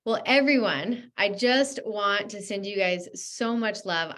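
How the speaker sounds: ordinary talk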